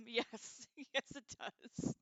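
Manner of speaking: usual speaking voice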